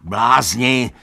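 high, loud outcry, building; agitated shouting. A narrator's reading. Donkey scolds the gathered animals, agitated shouting. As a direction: shout at them in frantic exasperation. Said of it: "křičel Benjamin".

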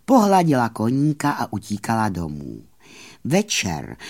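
usual speaking voice

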